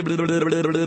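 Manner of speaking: put-on rolling blubbering baby noise; imitated baby sounds. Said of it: "Si su niño hace".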